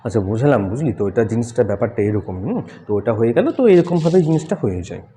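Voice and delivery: low, soft, mumbled and offhand; a low soft mumble, demonstrating how not to speak; mumbling low voice. Shown as a demonstration. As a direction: mumble it low as if talking to yourself. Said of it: "দেখবে এরকম করে কথা বলে".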